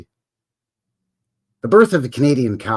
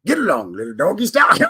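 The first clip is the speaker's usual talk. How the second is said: cowboy call; 4 semitones higher than usual. Pretend. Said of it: "hooting and hollering to get them little doggies".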